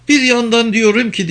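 high-pitched character voice; Penelope, wondering. Acted. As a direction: lift the pitch, thinking aloud.

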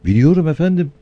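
high eager yes-sir; eager obedient reply. Acted. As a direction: answer like a keen pupil. Sami answers obediently.

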